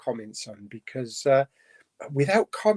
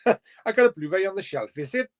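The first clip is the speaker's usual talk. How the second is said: higher mock-Welsh lilt; Welsh accent; 4 semitones higher than usual. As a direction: joke Welsh accent with a high tag question.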